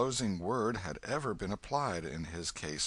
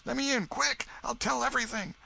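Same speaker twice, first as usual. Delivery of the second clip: put-on high, urgent boy voice pleading; frantic boy. Huck pleading at the Welshman's door, frantic. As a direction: beg to be let in, fast.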